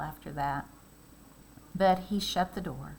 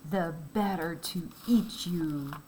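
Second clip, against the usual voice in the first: menacing wolf. Acted. Wolf pounces.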